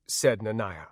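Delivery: ordinary delivery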